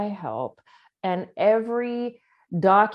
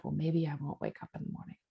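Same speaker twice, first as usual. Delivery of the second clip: softer, fast, low under the breath; hopeless muttered wish; 6 semitones lower, 13 dB quieter, faster than usual. Retold or imitated. Going to bed, quietly hoping not to wake, softly fast. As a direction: mutter it like a tired private wish. Bedtime hope of not waking.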